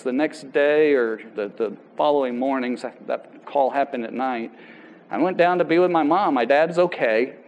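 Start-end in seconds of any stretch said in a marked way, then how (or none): none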